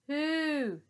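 high, loud trumpeting hoot; joyful mammoth hoo. Acted. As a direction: call out like a happy mammoth. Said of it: "hooted Woolly".

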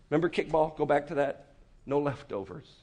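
usual speaking voice

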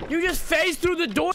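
high startled outburst; shocked as the character phases through the door; surprised yelp. Real, not acted. Startled cry at a glitch through the door.

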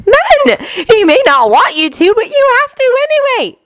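firm insistence. A narrator's reading. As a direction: leave him no room to refuse. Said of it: "said Winry firmly".

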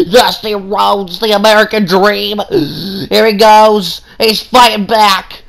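wrestling commentator voice; excited play-by-play. Acted. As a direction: call the match like a hyped announcer.

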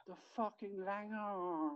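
high and slow; lightly childlike, drawn out; niece's voice. Retold or imitated. Uncle voicing niece's recorded line, high and slow. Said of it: "the other voice goes".

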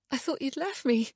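high-pitched nervous female character. A narrator's reading. Wife greeting Ian at the door, nervous. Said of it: "said with a nervous laugh".

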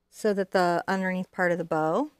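ordinary talk, nothing marked

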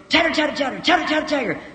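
high, rapid squirrel chatter. Retold or imitated. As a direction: imitate an excited little squirrel scolding. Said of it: "I heard a little pine squirrel".

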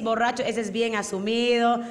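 ordinary talk, nothing marked

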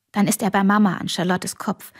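usual speaking voice

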